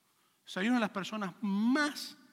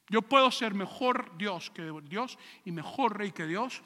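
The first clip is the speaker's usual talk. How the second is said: ordinary talk, nothing marked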